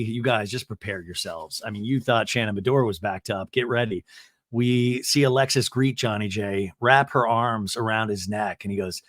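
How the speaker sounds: ordinary delivery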